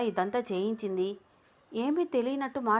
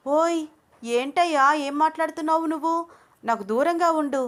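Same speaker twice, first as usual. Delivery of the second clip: alarmed outburst; 7 semitones higher, 5 dB louder than usual. Acted. Anu backing away from Ranga.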